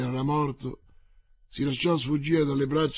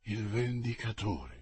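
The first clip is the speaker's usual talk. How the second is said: much softer, slow dying gasp; faint stammer; 5 semitones lower, 10 dB quieter than usual. A narrator's reading. The shot Winter stammers faintly in Athos's arms. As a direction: stammer it as life leaves.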